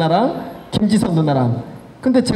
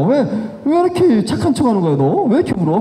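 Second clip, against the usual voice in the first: mock mocking friend; 5 semitones higher than usual. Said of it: "막 이러는 거예요".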